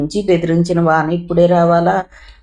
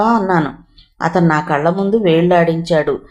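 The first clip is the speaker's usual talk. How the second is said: ordinary delivery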